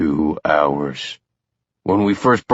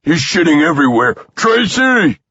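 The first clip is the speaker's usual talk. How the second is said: panicked call; 11 semitones higher, 4 dB louder than usual. A narrator's reading. Father panics, calls wife.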